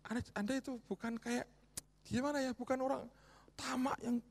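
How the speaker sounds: high, loud, driving exhortation; fiery preaching. Acted. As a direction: drive the appeal home.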